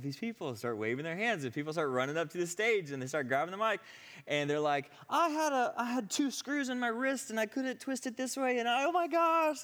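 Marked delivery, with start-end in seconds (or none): 5.1-9.6 high-pitched, loud, frantic testimony; freaking out. Retold or imitated. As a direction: imitate someone squealing with amazement at their own healing. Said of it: "like, they're freaking out".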